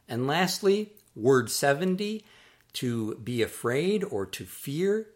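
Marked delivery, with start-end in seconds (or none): none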